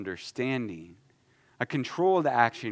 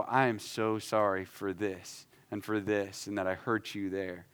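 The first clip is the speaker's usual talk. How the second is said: a soft, heartfelt "I am so sorry"; sincere apology; 5 semitones lower, 7 dB quieter than usual. Shown as a demonstration. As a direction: apologize softly and mean every word. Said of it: "those are very different".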